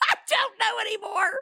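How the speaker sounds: high, quick, half-laughed squeal; laughing helplessness. Real, not acted. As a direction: give up through laughter, pitch way up. Laughing, giving up on naming job.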